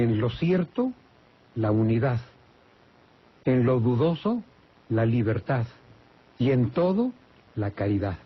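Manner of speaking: measured recital, pausing after each phrase; dictado de una máxima. Shown as a demonstration. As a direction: lift each condition, pause, drop on its answer. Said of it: "Es una regla de oro que dice". (máxima dictada con pausas)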